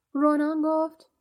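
usual speaking voice

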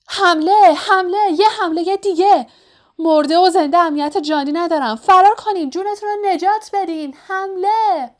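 high, loud shouting in a spirit's voice; alarm scream. A narrator's reading. Troublesome spirit raises the alarm in the corridor, high screaming. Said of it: "فریاد زد".

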